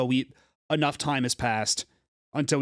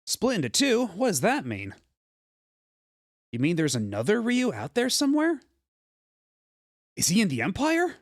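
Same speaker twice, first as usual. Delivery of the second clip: higher, startled questions from the hero; surprised hero; 6 semitones higher than usual. Acted. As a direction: ask in eager disbelief. Voices hero's questions.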